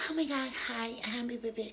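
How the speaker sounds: light sweet mimicry; girlfriend's sweet voice. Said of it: "she spoke like this".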